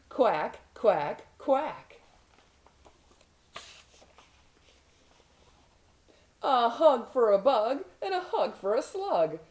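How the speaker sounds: bouncy, sing-song storybook duck; gleeful rhyming read with spaced-out quacks; excited duckling. Acted. Duckling glad it's raining and off to play, excited duckling. Reads picture book as duckling.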